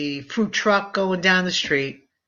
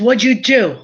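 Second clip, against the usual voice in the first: loud, louder alarmed father; father upset at his daughter's haircut; father's reaction; 4 semitones higher, 6 dB louder than usual. Retold or imitated. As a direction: demand it like a shocked dad. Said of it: "He says".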